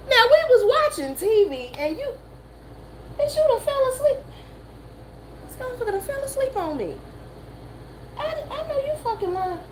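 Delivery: high, put-on voice teasing a friend for dozing off; mock scolding friend. To her friend who fell asleep, high mock scolding. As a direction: squeal it up high like you can't believe she fell asleep. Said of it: "I was like".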